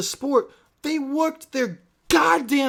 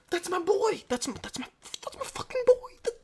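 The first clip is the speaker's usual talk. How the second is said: excited; 11 semitones higher, 6 dB quieter, faster than usual. Real, not acted. Cheering the driver on video.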